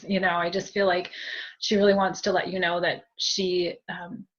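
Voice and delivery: ordinary talk, nothing marked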